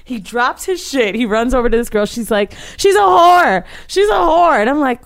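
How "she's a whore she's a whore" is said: high, loud wailed accusation; imitated hysterical yell. Storyteller voicing the tearful girl, high hammed-up screaming. Voicing distraught girl's outburst.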